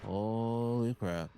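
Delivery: rising panicked oh God; terrified gibbering. Real, not acted. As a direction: babble in mounting terror. Monster moving, panic.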